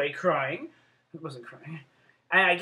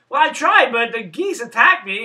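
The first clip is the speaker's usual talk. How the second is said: loud, higher retold protest; defensive protest; 5 semitones higher, 11 dB louder than usual. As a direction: protest loudly to a child, imitating yourself. Retold defensive answer to niece.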